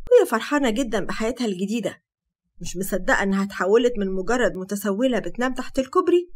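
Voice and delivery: plain reading voice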